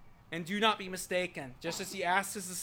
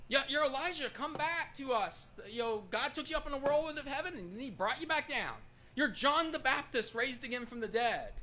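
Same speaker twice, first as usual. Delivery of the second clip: higher, swooping, quick chatter; people's eager claims; 7 semitones higher than usual. Acted. People telling Jesus who he is, excited higher-pitched claims. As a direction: toss out wild rumors brightly. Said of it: "that's what people are saying".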